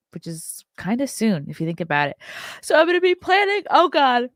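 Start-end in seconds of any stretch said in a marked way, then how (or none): 2.6-3.6 words stretched through a big yawn, much higher and louder. Real, not acted. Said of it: "sorry, I don't mean to yawn". 3.7-4.3 yawning. Real, not acted. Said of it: "sorry, I don't mean to yawn".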